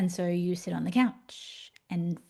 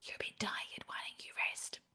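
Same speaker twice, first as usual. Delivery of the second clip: soft, gentle whisper; 16 dB quieter than usual. Retold or imitated. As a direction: whisper it kindly into the ear. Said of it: "whispers in your ear very gently".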